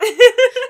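high, loud giggle; real giggle; laugh. Real, not acted. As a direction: laugh at her own joke. Host laughs.

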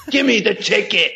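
bad British accent, laughing through it; mock British kid trying to sound grown up; hammed-up British accent with a laugh; worst British accent. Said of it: "in my worst British accent at eleven in the lowest pitch voice I could do".

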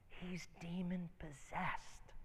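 slow, spooked whisper; whispered warning. Retold or imitated. Telling his friend the guy is possessed, whispered. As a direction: whisper it slowly, word by word.

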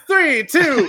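loud, high playful countdown; teasing countdown. Acted. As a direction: count down to pressure him.

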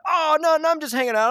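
mock-innocent denial; feigned innocence. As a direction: play the badly disguised culprit acting casual. Satan playing innocent as a snake.

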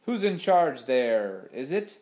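drawn-out shout ending in an awkward question. A narrator's reading. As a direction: call across to the other ship. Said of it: "shouted Smee to the other ship".

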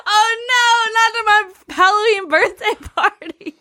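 high, overdone dismay; mock dismay. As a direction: feign dismay.

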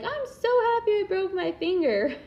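high, sarcastic cheer; mock happy. Mother mocking a cheerful injury. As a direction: fake delight over a broken finger. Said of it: "I'm not saying for you to be like".

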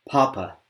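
papa with a burst on the p; unaspirated papa with a burst. Shown as a demonstration. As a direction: release papa in one burst. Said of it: "actually make a burst".

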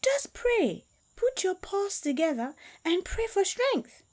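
very high, sing-song mock-pious voice; sly trickster advice. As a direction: fake piety, hiding the trick. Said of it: "wanted to play a trick".

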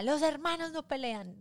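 mock yell. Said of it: "Solamente gritaba eso".

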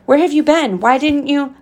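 father's high-pitched, worried scolding; narrated angry outburst with concern. A narrator's reading. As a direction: pitch up, firing anxious questions at a son. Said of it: "anger and concern in his voice".

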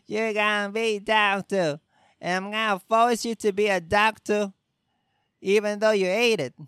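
hammed-up tyrant dad. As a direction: force it on the kid, pushy and loud.

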